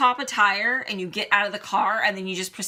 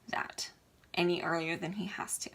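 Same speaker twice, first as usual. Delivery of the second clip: emotional whisper; 2 semitones lower, 7 dB quieter than usual. Real, not acted. Fear for dad's health.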